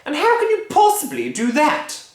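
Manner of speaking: high, loud, know-it-all scolding; nagging wife. Acted. Missus Cranky lecturing husband, high shrill nag. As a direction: lecture them like they're fools.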